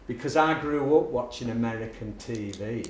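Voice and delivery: usual speaking voice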